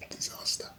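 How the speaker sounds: soft unvoiced whisper. Real, not acted. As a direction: barely breathe it. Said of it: "So hence the whispering".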